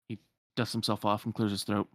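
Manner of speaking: ordinary delivery, nothing marked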